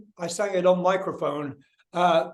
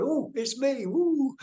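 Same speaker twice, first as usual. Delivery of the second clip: high, giddy mock-child voice; excited little kid; 8 semitones higher, much slower than usual. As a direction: squeal it lightly like a delighted kid. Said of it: "like a little kid going".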